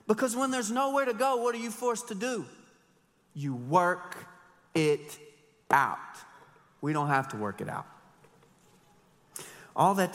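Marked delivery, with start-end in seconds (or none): none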